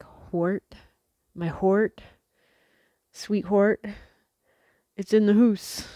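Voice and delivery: "Hort" for heart, "hoose" for house; Baltimore pronunciation. Retold or imitated. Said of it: "but they would say".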